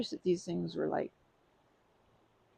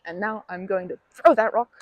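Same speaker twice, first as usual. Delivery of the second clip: much louder, gearing up; building to the throw; 3 semitones higher, 12 dB louder than usual. Real, not acted. About to hurl the pretend rock, excited and loud. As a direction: announce the big throw, pumped.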